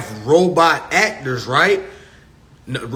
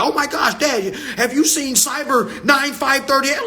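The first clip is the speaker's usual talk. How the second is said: put-on high, giggly kid gushing about a rapper; excited kid voice; 8 semitones higher than usual. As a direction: play a starstruck kid, much higher. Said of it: "them new kids gonna be like".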